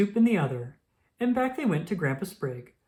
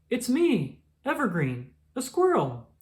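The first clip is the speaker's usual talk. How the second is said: lightly voiced squirrel, higher; a small squirrel answering a toad; a hint of a little squirrel; 7 semitones higher than usual. Squirrel character voiced high.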